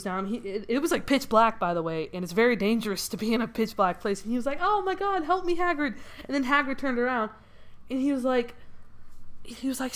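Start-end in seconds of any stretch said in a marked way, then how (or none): 4.6-5.9 scared plea. Retold or imitated. Scared kid calls for help.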